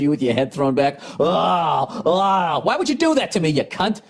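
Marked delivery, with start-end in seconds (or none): 1.2-4.0 high hammed-up anguished wail; mock anguish. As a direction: wail like he's been wronged. Mock anguish at mental image.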